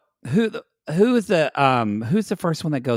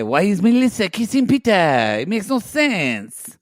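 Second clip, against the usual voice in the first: hammed-up French accent, higher pitched; 5 semitones higher than usual. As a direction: imitate a Frenchman with a cartoonish lilt. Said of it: "I forgot he was French".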